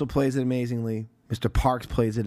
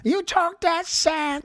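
high, drawn-out mock voice; mimicking her line; much slower than usual. Imitating what she said in the movie, high drawn-out mimicry. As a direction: stretch it out in a high put-on voice. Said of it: "'cause what she said".

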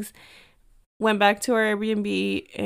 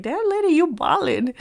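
impressed teasing; 8 semitones higher, 4 dB louder than usual. Retold or imitated. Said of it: "I was like".

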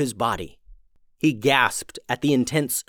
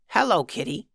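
little goblin; 5 semitones higher than usual. Acted. Goblin greets the cat.

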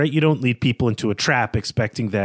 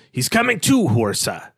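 northern man voice. Acted. Host voices the man who finds Conan, northern man voice. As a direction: call it out as a rough-and-ready northern man. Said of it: "that's my northern man, uh, voice".

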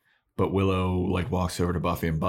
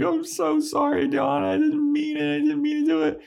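squeaky falsetto apology; mock pleading Willow; 3 dB louder than usual. Willow apologizing to Dawn, high mock pleading. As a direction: beg forgiveness in a very high mock whine. Willow begs Dawn's forgiveness.

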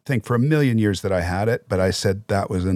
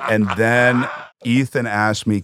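usual speaking voice

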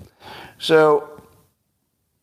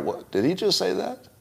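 soft, high, rising disbelief; puzzled double take; 8 semitones higher, 6 dB quieter than usual. Acted. Listener needing a moment to catch the insult, baffled. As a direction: quietly query it, pitch climbing at the end. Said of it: "takes you a moment to figure out".

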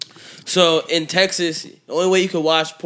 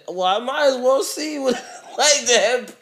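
laughing; 9 semitones higher than usual. Retold or imitated. Retold own thought, laughing.

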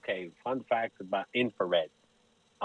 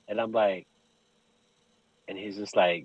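usual speaking voice

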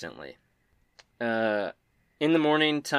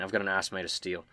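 ordinary talk

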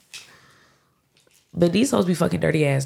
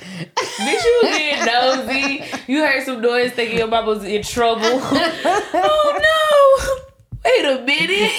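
teasing through laughter; laughing; 6 semitones higher, slower than usual. Real, not acted. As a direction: laugh while accusing her.

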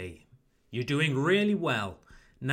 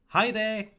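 "Hi there" pitched high and louder; warm greeting intonation; 7 semitones higher, 5 dB louder than usual. Shown as a demonstration. English teacher models a greeting for learners, warm intonation. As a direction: lift "Hi there" brightly high, as a friendly greeting. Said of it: "Try to use my intonation".

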